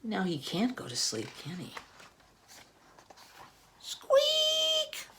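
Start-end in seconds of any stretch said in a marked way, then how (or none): 3.8-5.1 a tiny mouse squeak, very high. Acted. For the children, a high mouse squeak.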